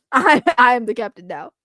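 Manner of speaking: put-on movie-quote African accent. Quoting a pirate taking over, African accent. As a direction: claim command in a mock African accent. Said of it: "where I sound like a African".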